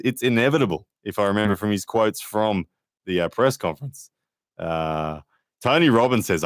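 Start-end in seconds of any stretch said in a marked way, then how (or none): none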